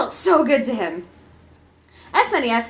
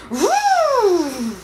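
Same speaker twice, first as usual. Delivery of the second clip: vent whoosh; 8 semitones higher than usual. Acted. Air vent going crazy.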